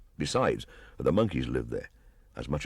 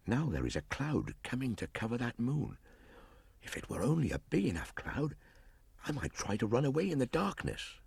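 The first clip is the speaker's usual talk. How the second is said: put-on quiet musing boy; 8 semitones higher, 5 dB quieter than usual. Boy musing alone at night.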